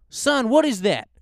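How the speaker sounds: high, loud gruff old man impression; mocking an old man's blunt question; old customer voice. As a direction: boom it like a loud old regular.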